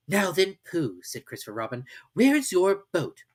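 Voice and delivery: put-on high, eager boy's voice; an excited little boy; Christopher Robin voice. A narrator's reading.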